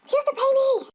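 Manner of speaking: high, loud demand; loud, high-pitched protest; indignant shout. Acted. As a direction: shout after a customer who is leaving. Psychic calls after leaving customer.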